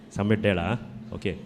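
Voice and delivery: normal talking voice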